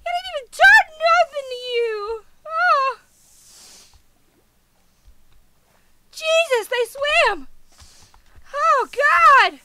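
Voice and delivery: high, loud rattled cry; distressed wail. Real, not acted. Gamer rattled by an unprovoked attack, wailing high and loud. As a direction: wail it out in dismay.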